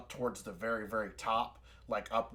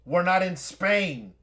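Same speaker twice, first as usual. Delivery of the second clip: high, loud, drawn-out shout; yelled retort; 7 semitones higher, 11 dB louder, slower than usual. Acted. People on the boat answering the Port Authority call, yelled retort. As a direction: yell it back indignantly, stretching the words. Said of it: "that's what their response is".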